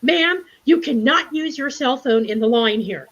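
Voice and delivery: mock Chipotle lady scolding her in line. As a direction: imitate a bossy customer telling you off. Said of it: "And I heard this voice".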